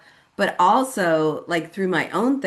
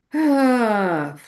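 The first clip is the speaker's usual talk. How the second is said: loud, high exhale falling away; heavy sigh; 6 semitones higher, 4 dB louder than usual. Real, not acted. Speaker pauses in her own story, heavy sigh. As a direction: let out a long sigh.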